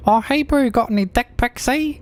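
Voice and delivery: high, exaggerated Kiwi bloke voice; hammed-up New Zealand accent. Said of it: "attempt at a New Zealand accent".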